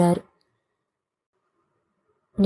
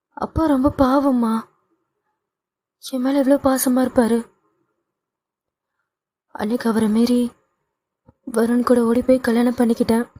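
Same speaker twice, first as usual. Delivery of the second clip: put-on higher, mildly tearful young woman; pleading daughter; 4 semitones higher than usual. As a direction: plead with mother, a touch of tears.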